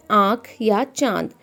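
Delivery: said slowly, each word drawn out for the class; slow, clear example. Shown as a demonstration. Teacher showing examples to students, slow and clear. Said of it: "जैसे".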